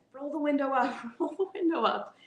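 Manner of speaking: high-pitched mock yell; retold mom's yell. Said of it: "My mom yelled at him".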